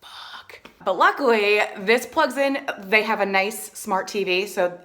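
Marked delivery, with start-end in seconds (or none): 0.0-0.6 whispered curse. Retold or imitated. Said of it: "So I was like".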